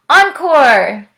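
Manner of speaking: loud, high cheer; audience shout. A narrator's reading. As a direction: call out like a clapping crowd. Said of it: "if we clap loud and shout".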